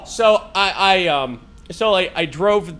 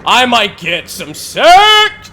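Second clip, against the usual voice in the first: eager scheming; 4 semitones higher, 7 dB louder than usual. Retold or imitated. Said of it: "I was like".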